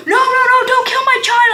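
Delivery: imitating a freaked-out friend; mock panic. Friend pleading not to kill his child, mock panic. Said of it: "he's like".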